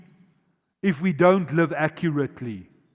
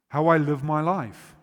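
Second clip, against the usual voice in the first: ordinary delivery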